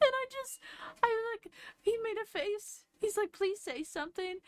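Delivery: squeaky high voice, fighting back a laugh; laughing through it. Real, not acted. Telling the prom story, barely holding in laughter. Laughing while retelling his pleading.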